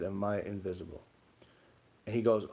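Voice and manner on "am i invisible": softly asked, barely voiced; quiet. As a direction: murmur the question quietly. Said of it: "Just like that".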